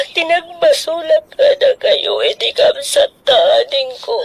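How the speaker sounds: tearful cry rising high. Acted. Distraught woman blaming herself, crying out high. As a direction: wail the words out through tears.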